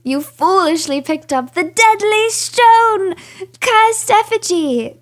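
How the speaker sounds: high, slow hammed-up proclamation; theatrical, drawn-out exclaiming; dramatic curse announcement. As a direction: read the curse out with mock doom. Reads out the game's curse message.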